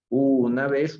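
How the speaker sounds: ordinary talk, nothing marked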